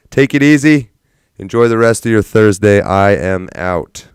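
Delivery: big sign-off. Acted. Streamer signing off to viewers, loud farewell. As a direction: send them off with a booming goodbye. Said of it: "signing off loudly".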